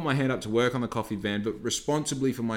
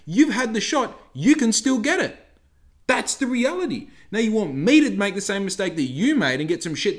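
much higher, louder rant; heated rant; 10 semitones higher, 5 dB louder than usual. Retold or imitated. Barista ranting at a lecturing customer, high and loud. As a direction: rant like you're fed up at the counter.

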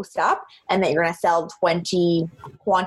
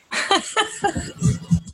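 laugh; 13 semitones higher than usual. Real, not acted. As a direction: laugh it off at herself. Self-deprecating joke about selling.

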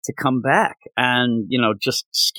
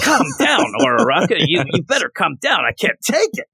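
hammed-up overwhelmed friend; pitched-up joking protest; mock exasperated; 6 semitones higher than usual. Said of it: "She was so fired up".

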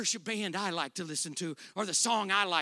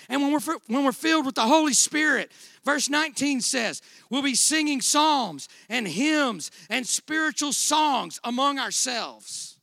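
preaching; 7 semitones higher, 10 dB louder than usual. Acted. Preaching at full force.

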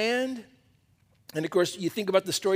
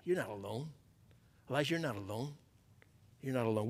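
gentle reassurance; 6 semitones lower, 12 dB quieter, faster than usual. Acted. God reassuring Elijah.

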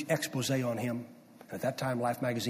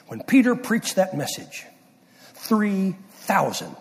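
higher and louder, three thousand stretched very slow; preaching climax; 7 semitones higher, 7 dB louder, slower than usual. Real, not acted. Preacher to congregation, high, loud, then drawn out.

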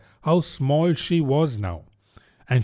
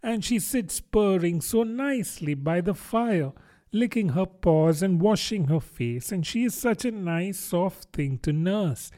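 fond, higher musing; lightly voiced, absent-minded reverie; half to herself; 6 semitones higher than usual. A narrator's reading. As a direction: ramble on dreamily as a girl about a pet. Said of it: "half to herself as she swam lazily".

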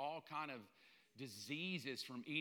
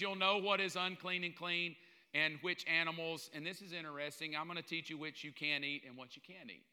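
normal talking voice

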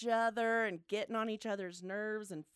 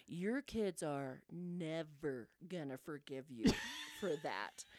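low, subdued husband's reproach; grave verdict; 6 semitones lower, 6 dB quieter than usual. Retold or imitated. Husband tells mom kids won't forgive, low and grave. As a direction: pronounce it low and softer, letting it sink.

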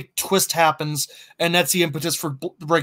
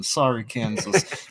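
softer, slow, mock-solemn sorry; mock apology; 6 semitones lower, 5 dB quieter, slower than usual. Co-host jokingly apologizing to Kansas, soft, slow mock-solemn. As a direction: apologize to Kansas with a straight face, slowly.

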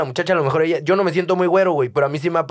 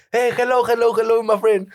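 agudo, saludo exagerado; turista gringo; 7 semitones higher than usual. Retold or imitated. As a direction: imita al gringo perdido. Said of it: "me dice". (turista estadounidense saluda, agudo y exagerado)

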